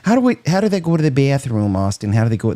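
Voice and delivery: quick imitation of a Michigan accent; lightly put-on Michigan accent. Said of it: "That's [name]'s, uh, Michigan accent".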